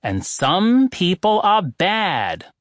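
slow, deliberate "Some people are good"; slow and loud. Acted. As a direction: spell it out slowly for people who seem not to hear. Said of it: "said slowly and loudly".

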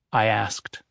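ordinary delivery, nothing marked

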